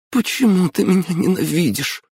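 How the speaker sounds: put-on a hurt girl asking through tears; sobbing girl. A narrator's reading. Girl asks her brother why he hates her, in a high, tearful voice. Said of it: "всхлипнула девушка".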